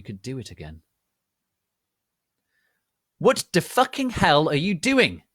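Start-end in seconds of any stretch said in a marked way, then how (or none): 3.2-5.2 loud, high furious yell; angry shout. A narrator's reading. Narrator to the visitor at her door, angry shout. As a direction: blast it at the door. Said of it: "I shouted angrily".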